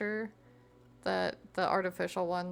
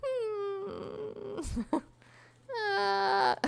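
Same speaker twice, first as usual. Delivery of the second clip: laughing whimper; 11 semitones higher than usual. Real, not acted. Laughing reaction to cockroach.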